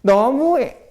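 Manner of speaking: loud, high-pitched mock sulk; upset daughter. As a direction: protest like a sulking daughter. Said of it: "갑자기 우리 딸이 막 이렇게 하거든". (삐친 딸 흉내 내는 큰 소리)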